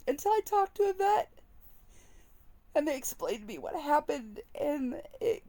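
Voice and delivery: fighting back tears while confessing; crying. Real, not acted. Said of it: "and now I'm gonna cry".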